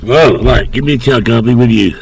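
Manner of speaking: ordinary talk, nothing marked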